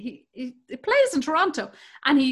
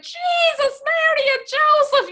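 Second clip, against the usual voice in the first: climaxing Irish woman; 13 semitones higher, 4 dB louder than usual. Acted. Comedian demonstrating an Irish woman in bed, mock-ecstatic cry. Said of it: "You should do an Irish woman".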